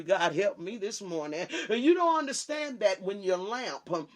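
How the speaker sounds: ordinary talk, nothing marked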